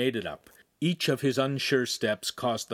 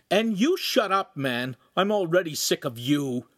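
higher, angry snap at the bully; growled; 6 semitones higher, 4 dB louder than usual. A narrator's reading. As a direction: spit it out in fed-up anger. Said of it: "Lincoln growled".

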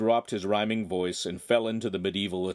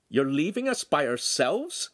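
delighted, higher-pitched question; 7 semitones higher than usual. A narrator's reading. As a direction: ask it in delight. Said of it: "he asked in a delighted tone".

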